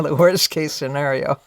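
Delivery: ordinary talk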